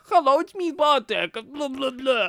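high, loud mocking take on his voice; teasing the absent cohost, high and loud; mock Bartek impression. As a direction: pretend to be Bartek, talking nonsense. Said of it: "pretend that you're here".

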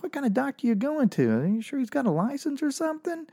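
high-pitched mock disbelief; incredulous retort. Comic replaying his reply to girlfriend, high and incredulous.

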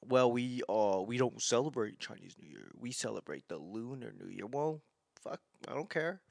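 a snooty correction, half-muttered; mocking critic. Mocking someone who corrects him, in a soft snooty voice. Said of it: "that's how I feel".

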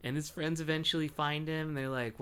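normal talking voice

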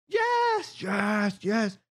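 very high-pitched mock crowd cheer; riled-up crowd yell. Crowd cheering on a hanging, high mock yell. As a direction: cheer like a whole mob in a high, thin voice. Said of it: "proceed to all yell and go".

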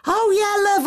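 high-pitched put-on Manchester voice; Manchester accent. Greeting a woman in the queue, mock Manchester accent. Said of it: "that was a Manchester accent".

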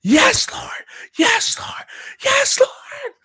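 high, loud shouted praise; rising ecstatic shout; screaming praise. Retold or imitated. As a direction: cry it out like a freed lion. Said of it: "I kept screaming".

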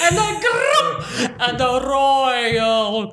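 long, drawn-out cowardly lion growling; hammed-up royal growl, stretched right out; Bert Lahr impression. Clowning as the lion from The Wizard of Oz, hammed-up growl. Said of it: "Bert Lahr from The Wizard of Oz".

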